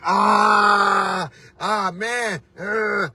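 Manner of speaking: drawn-out "ah" of frustration; frustrated groan. Retold or imitated. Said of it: "And I was frustrated".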